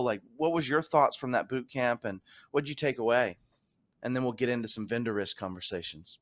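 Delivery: ordinary talk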